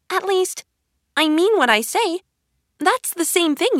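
put-on high-pitched girl character voice; Alice insisting. Alice correcting herself to the tea party, high and insistent. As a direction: defend yourself in a high voice.

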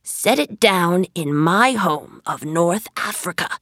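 magician's firm order; emphatic, understated command. A narrator's reading. Magician orders palace moved to Africa, commanding. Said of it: "commanded the magician".

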